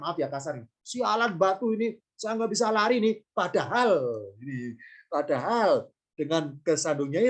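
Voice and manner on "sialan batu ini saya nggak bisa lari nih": ordinary talk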